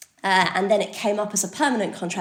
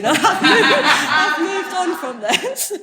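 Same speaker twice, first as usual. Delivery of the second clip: laughing through the words, high and loud; 9 semitones higher, 9 dB louder, much slower than usual. Real, not acted. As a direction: giggle it out, embarrassed at the joke. Jokes about employer in room.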